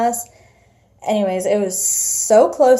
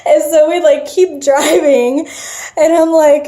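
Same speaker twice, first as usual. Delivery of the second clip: usual speaking voice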